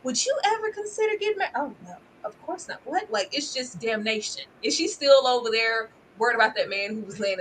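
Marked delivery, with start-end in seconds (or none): 0.0-1.5 mock question. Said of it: "And she said". 1.6-2.8 soft, airy brush-off; dismissive mock reply. Recapper imitating the mother's soft dismissal. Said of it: "And she said".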